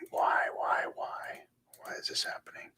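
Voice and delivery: exasperated whispered why; whispering. Real, not acted. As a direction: whisper in despair at the screen. Frustrated at computer, whispered.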